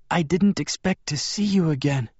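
high-pitched frail woman's voice; Bella. A narrator's reading. Bella greets a visitor she didn't expect, high frail voice. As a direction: lift the pitch high for a weakened female character.

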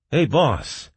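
brash, punchy call; barked. A narrator's reading. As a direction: bark it out to the boss. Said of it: "barked Baum".

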